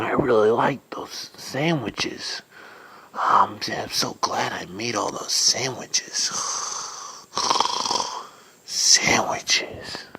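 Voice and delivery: whispered mumbling in a dream; sleep-talking whisper. Acted. Dreaming dude whispering about his sandwiches in sleep. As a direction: murmur happily while asleep.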